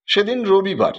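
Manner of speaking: ordinary delivery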